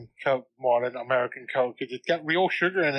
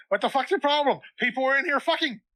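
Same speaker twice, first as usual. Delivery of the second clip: annoyed yell; 10 semitones higher than usual. Retold or imitated. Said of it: "And she's like".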